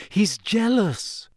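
put-on a clever girl reasoning it out; Hermione voice. A narrator's reading.

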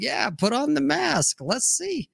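playful comeback. Retold or imitated. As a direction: toss it back lightly, unbothered. Voicing a playful unbothered reply.

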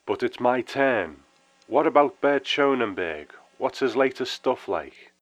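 a lightly voiced brighter tone; brightening. A narrator's reading. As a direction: perk up, eager to ask.

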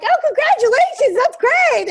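loud, high, hammed-up congratulations; mock cheery dad, breezily pleased; typical dad. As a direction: imitate an oblivious dad's big congrats. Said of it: "And a typical dad".

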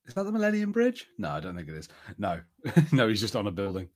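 ordinary talk

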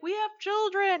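high, loud retold protest; protesting call. Mother turning down a bar bouncer, protesting call. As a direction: call it back in mock indignation.

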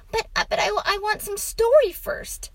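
put-on high, loud child voice; protesting boy. A narrator's reading. Boy wants story before sleep, protesting. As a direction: stammer a protest in a boy's pitch.

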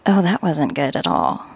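normal talking voice

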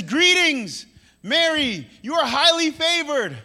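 high, eager proclamation; excited greeting. Acted. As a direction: hail someone with too much energy. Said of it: "Why are you too excited?".

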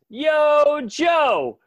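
high, loud battle cry; yelling. Retold or imitated. Dean bursts in on the Insect King with a loud, high yell. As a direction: charge in hollering it. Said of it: "Dean rushes in and yells".

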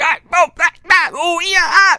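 high, hammed-up dubbed exclamations; over-the-top mock movie yelps; badly dubbed one-liners. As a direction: mimic bad action dubbing in short high bursts. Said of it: "bad one-liners".

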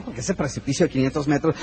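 ordinary talk, nothing marked

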